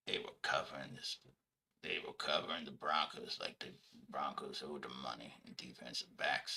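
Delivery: a deep, hushed, deliberate analyst handing out grades; Mike Preston impression. Columnist grades the Ravens defense and offense, low hushed impression. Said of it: "my, uh, Mike Preston".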